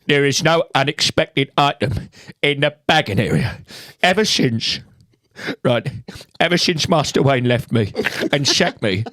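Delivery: exasperated, halting actor impression; Michael Caine. Man arguing with a self-checkout machine, Caine impression. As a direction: stammer in indignation, in character. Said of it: "Michael Caine arguing with a self-checkout machine".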